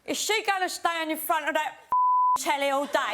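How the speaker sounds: put-on high, loud grumbling old lady; grumpy old lady. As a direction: complain like a cranky pensioner. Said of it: "she went".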